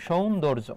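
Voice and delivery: সৌন্দর্য in clear syllables, "shoun-dor-jo"; pronunciation demo. Shown as a demonstration. Teacher puts the syllables together, সৌন্দর্য pronounced as "shoun-dor-jo". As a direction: model সৌন্দর্য with a gliding first vowel. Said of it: "এটা হচ্ছে তোমার উচ্চারণ".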